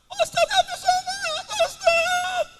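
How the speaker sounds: put-on high, slow excited kid calling; high, drawn-out eager call; excited child. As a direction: greet the famous preacher like a thrilled child. Child greets visiting preacher.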